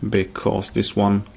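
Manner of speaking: ordinary talk, nothing marked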